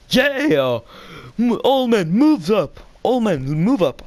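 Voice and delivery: high-pitched rallying cry, imitated; mock battle call. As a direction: call the troops forward, drawn high. Anakin's battle call acted out.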